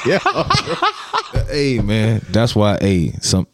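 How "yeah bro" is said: laughing. Real, not acted. Laughs along.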